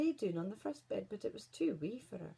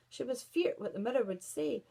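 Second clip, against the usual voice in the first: plain reading voice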